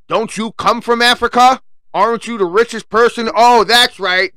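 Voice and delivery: sarcastic jab. Real, not acted. Sarcastically addressing Elon Musk.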